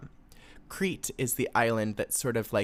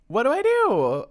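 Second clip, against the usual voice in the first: helpless outrage; 8 semitones higher, 5 dB louder, slower than usual. Retold or imitated. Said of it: "you're just like".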